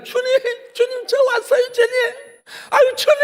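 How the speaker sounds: put-on nervous teen sucking up to Jesus, high teary cutesy plea; cutesy pleading. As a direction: whimper sweetly to win his favour. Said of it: "애교 떨고 있는 거예요".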